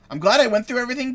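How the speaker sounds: excited burst. Real, not acted. The player glad at finally solving the puzzle, excited burst. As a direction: blurt it out, pleased.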